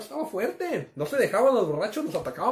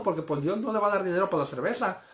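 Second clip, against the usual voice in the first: ordinary delivery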